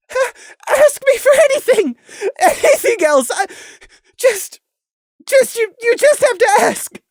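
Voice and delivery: high, loud, stammering; desperate high plea. Acted. Prey pleading with Crimson to ask anything else, high and loud.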